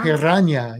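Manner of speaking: piranha with a trilled R and a nya ending; piranha, Spanish-style, rolled R. Shown as a demonstration. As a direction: roll the R in piranha, end on nya. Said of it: "You gotta roll the R".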